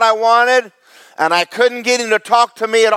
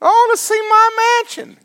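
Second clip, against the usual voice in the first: much higher, mocking whine; mock greedy believer. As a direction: squeak it like a spoiled child begging for a prize. Mocking believer who wants riches.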